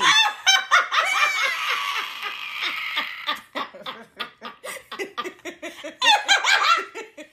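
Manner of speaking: long high squealing laugh; helpless high laughter. Real, not acted. Hosts cracking up at the joke, squealing laughter. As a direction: laugh until it trails away.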